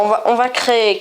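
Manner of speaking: ordinary talk, nothing marked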